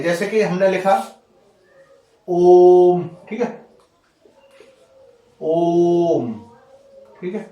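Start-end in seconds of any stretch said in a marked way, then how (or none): 2.3-3.1 ओम held very long and loud; prolonged vowel. Shown as a demonstration. Teacher showing an extra-long vowel, prolonged. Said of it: "जिनके उच्चारण में अधिक समय लगे". 5.4-6.3 ओम drawn out long on a level note; prolonged vowel. Shown as a demonstration. Teacher showing an extra-long vowel, prolonged. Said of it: "जिनके उच्चारण में अधिक समय लगे".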